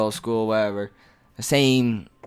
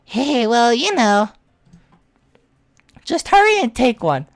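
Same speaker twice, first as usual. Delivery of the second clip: put-on flustered girl voice; 9 semitones higher, 4 dB louder than usual.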